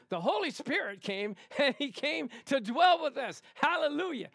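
high, loud, exuberant burst; fired-up, hallelujah-style delivery; excited preaching. Shown as a demonstration. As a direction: shout it joyfully with a laugh breaking in. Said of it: "it's like this".

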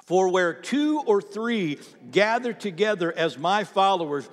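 loud, slow, rising on I am there; reading aloud together. Shown as a demonstration. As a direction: lead the church in reading. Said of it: "Read out loud with me".

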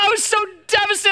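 normal talking voice

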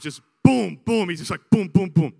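usual speaking voice

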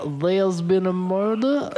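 put-on Creole accent with lisp. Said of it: "the weird lisp in there".

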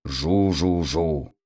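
low, slow propeller drone; imitated engine buzz; mock airplane cry. A narrator's reading. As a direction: drone softly like a plane, drawing it out. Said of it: "и кричал он".

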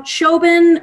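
"Chobin" as a high, loud wrong guess at Siobhan; mock mispronunciation. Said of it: "every way you can pronounce my name".

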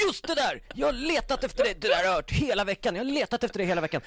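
hammed-up guard telling him off; imitating angry guard. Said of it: "Han bara". (härmar arg vakt, högt och snabbt)

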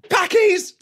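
high shout. Retold or imitated. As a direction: yell it out high and loud. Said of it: "you shout".

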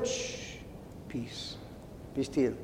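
very soft, low shush and soothing words; quiet a worrier down, almost under the breath; hushed divine calming; gentle hushing. Retold or imitated. God hushing the protester.

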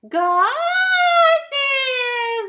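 high, drawn-out creaking wail; creaky door voice. Shown as a demonstration. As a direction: creak the words like an old door. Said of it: "I creak over it and I yell insults at Gus".

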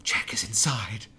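unvoiced mock whisper, slowed. Retelling a character's warning in a whisper. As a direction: hush it like a warning.

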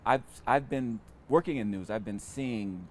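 ordinary delivery

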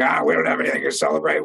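low, fast, gruff grumble. Retold or imitated. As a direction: imitate a gruff man brushing the question off. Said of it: "had this very gruff voice".

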